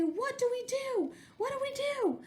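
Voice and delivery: high frantic cries; panicked. A narrator's reading. Duck and Goose panicking over rumbling egg, frantic. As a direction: squeal it in a panic.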